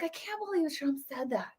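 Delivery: softly mimicking a shocked voter; quiet disbelief. Imitating the independent voter reacting to the news, quietly disbelieving. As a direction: mutter it like a stunned bystander. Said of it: "independent voter will be like".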